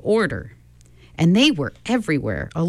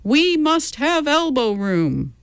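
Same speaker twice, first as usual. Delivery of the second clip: crowd cry; 9 semitones higher, slower than usual. A narrator's reading. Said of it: "the general cry was".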